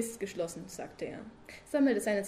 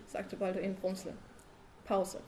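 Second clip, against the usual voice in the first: ordinary delivery, nothing marked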